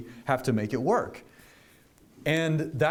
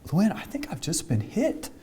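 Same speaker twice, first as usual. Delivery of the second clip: surprised; 6 semitones higher than usual. Retold or imitated. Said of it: "and he went".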